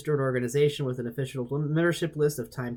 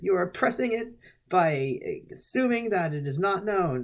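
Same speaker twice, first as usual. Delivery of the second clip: pitched-up accusing protest; mock indignation; 6 semitones higher than usual. Crank answering a baffled doubter, mock indignation. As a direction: lightly play an affronted theorist. Ray's side of the acted argument.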